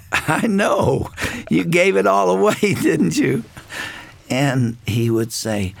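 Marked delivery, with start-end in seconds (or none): 0.1-3.5 lightly voiced mother, higher and laughing through it; mother's teasing. Mother teasing dad about giving the money away, high and laughing. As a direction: tease him fondly as his wife, with a chuckle.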